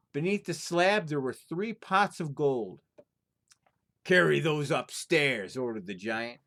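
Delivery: lively storyteller reading a folktale aloud; story narration. A narrator's reading. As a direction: tell it like a fireside tale, full of drama. Reads folktale aloud to viewers.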